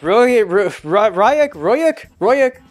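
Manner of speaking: trying out Royuk, stretched up into a rising question; fumbled name. Shown as a demonstration. Reading out a strange name in the book, unsure pronunciation. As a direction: Royuk tried over and over with a rising lilt. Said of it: "let's go with Royuk".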